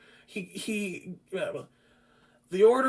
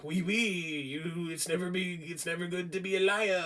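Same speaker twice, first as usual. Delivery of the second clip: lightly voiced French accent, half-hearted; bad French accent; 8 dB quieter than usual. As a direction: fumble through a clumsy French accent for Antoine. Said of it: "I can't do good French accent".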